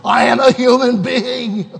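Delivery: rising to a full protest yell. Retold or imitated. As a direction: shout it back at the screen. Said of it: "I want to yell at the T V screen".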